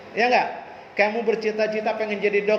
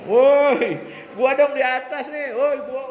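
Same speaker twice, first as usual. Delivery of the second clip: higher, cocky shout from the top; a kid bragging to everyone below, higher and cocky; boastful brag; 7 semitones higher, 4 dB louder, slower than usual. Retold or imitated. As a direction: crow like a show-off kid on the ladder. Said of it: "Bangga, sombong".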